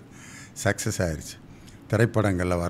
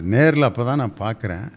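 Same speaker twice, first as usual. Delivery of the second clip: normal talking voice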